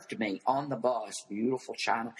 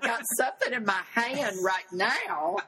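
words bubbling up through real laughter, higher; laughing; 11 semitones higher than usual. Real, not acted. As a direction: laugh out the punchline at your own story. Punchline told while laughing.

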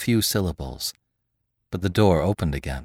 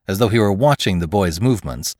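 ordinary delivery

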